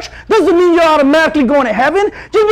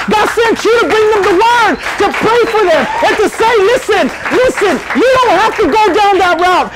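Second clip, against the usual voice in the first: higher, pressing sermon call; fired-up preaching; 4 semitones higher than usual. Acted. Preacher urging the youth to be the answer, fired up. Preaching builds to full force.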